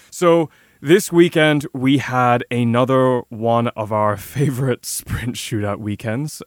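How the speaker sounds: slow, drawn-out mock enthusiasm with a stifled laugh; sarcastic. Real, not acted. Podcast host introducing a disliked sprint format to listeners, sarcastic. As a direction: lay the sarcasm on slowly. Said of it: "detect the sarcasm in my voice".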